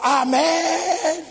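high, drawn-out mock amen; long held crowd response; congregation's cry. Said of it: "toda la iglesia gritaba".

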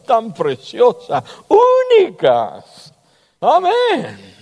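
high, slow, ringing preacher's cry; fervent preaching. Acted. Pastor exalting the congregation's worth, in soaring preaching. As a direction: lift each word up in praise.

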